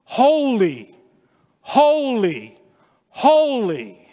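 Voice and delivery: high, loud proclaiming of each holy; angels' praise. Retold or imitated. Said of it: "it's thrice".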